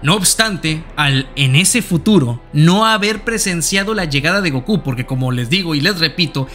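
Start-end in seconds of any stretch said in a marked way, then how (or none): none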